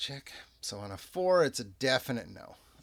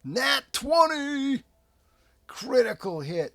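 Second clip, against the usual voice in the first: high, loud cheer with drawn-out words; excited critical-hit call; 13 semitones higher, 11 dB louder than usual. Real, not acted. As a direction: shout the lucky roll in triumph. Rolls a natural twenty.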